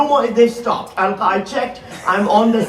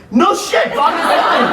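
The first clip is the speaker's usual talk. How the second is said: excited; 6 semitones higher, slower than usual. Real, not acted. Comedian greeting a Pakistani in the crowd, delighted. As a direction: cheer the audience member's home country.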